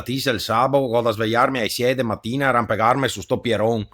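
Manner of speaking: ordinary delivery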